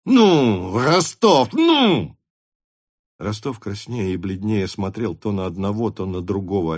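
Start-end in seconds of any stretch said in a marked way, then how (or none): 0.1-2.2 loud, climbing high cries; urging shout. Acted. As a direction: push him to answer, louder and higher. Denisov shouts, urging Rostov on. (громко, требовательно понукая)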